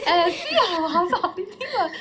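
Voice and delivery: slow, high, laughing voice like a ghost; mock spooky giggle; eerie laugh. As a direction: laugh it out eerily. Imitating ghostly laughter sound.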